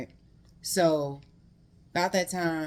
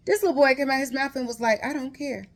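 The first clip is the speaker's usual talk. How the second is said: disbelief; 8 semitones higher, 4 dB louder, faster than usual. Real, not acted. Shocked retelling of boy's reply.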